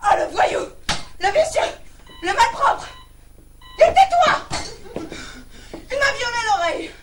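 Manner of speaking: loud, high-pitched burst of insults; outraged shouting. Acted. Woman insulting an obscene caller, outraged shouting. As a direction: hurl each insult in hammed-up outrage.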